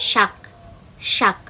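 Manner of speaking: শাক with a clear palatal sh; tongue on the palate for শাক; teacher demonstrates the palatal s. Shown as a demonstration. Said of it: "শাক খেয়েছি".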